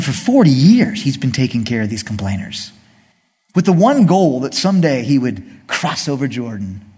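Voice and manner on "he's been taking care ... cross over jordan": ordinary delivery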